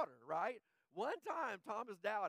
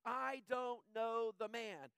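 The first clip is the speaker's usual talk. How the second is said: slow, deliberate denial; slow sworn denial; 3 semitones higher, much slower than usual. Retold or imitated. Peter swears to the bystanders, slow and deliberate. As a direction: spell out each word of the oath. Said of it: "he swore to them".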